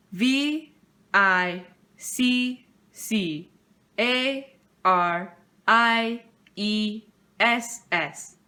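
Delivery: slow letter-by-letter spelling; spelled out slowly. Acted. Fifth grader spelling the word for the principal, slow letters.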